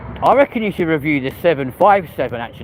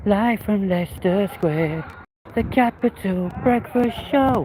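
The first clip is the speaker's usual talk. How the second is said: higher, slow radio jingle announcement; 7 semitones higher, slower than usual. Pretend. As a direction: sing it out like a station ident. Mock radio station jingle.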